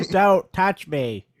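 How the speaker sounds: slow, high, begging robot voice; pleading robot. Pretend. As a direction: draw it out like a scared machine. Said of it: "the robot's like".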